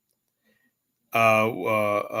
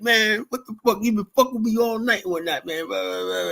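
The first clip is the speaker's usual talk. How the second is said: mocking the angry guy; 10 semitones higher than usual. Voicing drunk guy's complaint.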